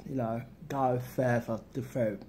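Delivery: ordinary talk, nothing marked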